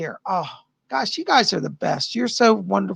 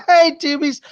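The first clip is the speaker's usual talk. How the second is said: excited; 6 semitones higher, 4 dB louder than usual. Real, not acted. Greeting viewers, excited.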